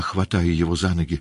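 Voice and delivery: ordinary delivery, nothing marked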